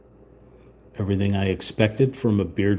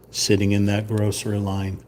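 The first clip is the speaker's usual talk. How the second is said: ordinary talk, nothing marked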